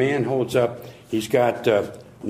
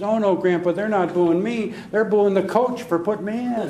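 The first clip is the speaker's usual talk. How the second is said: put-on cheerful kid; 8 semitones higher than usual. Cheerful grandson reply.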